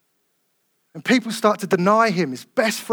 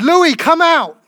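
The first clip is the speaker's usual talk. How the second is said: loud, high call into the tomb; 8 semitones higher, 6 dB louder, much slower than usual. Acted. Jesus calling Louis out of the tomb, loud and high. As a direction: summon him out with a ringing call.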